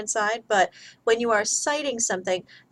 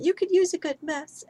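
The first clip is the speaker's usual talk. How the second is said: mild mock shout; 8 semitones higher than usual. A narrator's reading. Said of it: "she shouts at him".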